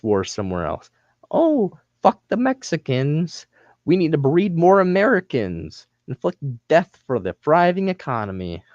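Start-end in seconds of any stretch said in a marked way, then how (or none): 1.3-8.6 mock Trump supporter. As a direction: play a dumb bigot mockingly.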